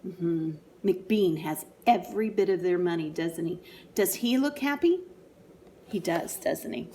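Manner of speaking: plain reading voice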